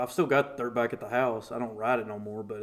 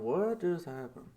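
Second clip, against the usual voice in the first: dazed question; 2 semitones higher, 9 dB quieter than usual. Retold or imitated.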